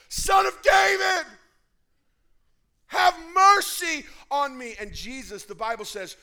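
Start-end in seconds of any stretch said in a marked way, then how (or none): 0.1-4.8 very high, very loud cry; screaming louder. Acted. Blind beggar screaming louder to Jesus despite the crowd. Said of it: "he screams louder".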